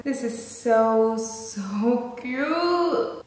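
ordinary talk

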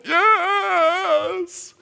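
high drawn-out mock wail; crying little girl. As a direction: wail it like a weeping child. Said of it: "the girl starts crying again".

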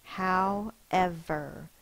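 "However" stretched out slow; blended slowly. Shown as a demonstration. Teacher blending the sounded-out word, slow and drawn out. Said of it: "Our controlled vowel goes in one box".

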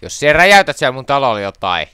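high, very loud threat; loud warning. Real, not acted. Player warns friend about blowing up house, shouted warning.

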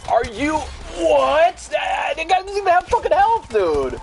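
very high, loud, drawn-out shriek; incredulous screaming. Real, not acted. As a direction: scream in disbelief at an unfair kill. Yelling in disbelief at game death.

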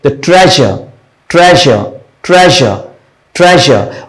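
ट्रेजर with a soft whistling zh in the middle; soft zh sound. Shown as a demonstration. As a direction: let the zh in ट्रेजर hiss softly like a whistle. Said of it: "मैं सिर्फ बोलकर ही आपको बता सकता हूं कैसा sound". (ट्रेजर में हल्की सीटी जैसी झ़ की आवाज़)

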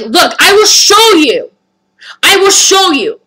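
loud, high, drawn-out declaration; slowly and forcefully promise proof; defiant insistence. Retold or imitated. Customer to staff who doubt her, defiant insistence.

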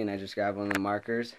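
usual speaking voice

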